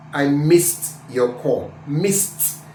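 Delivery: MISSED said slowly, ending in a crisp T; final t. Shown as a demonstration. Teacher giving an example, MISSED with released T. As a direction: end MISSED on "st", not D. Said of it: "Missed is gonna be T".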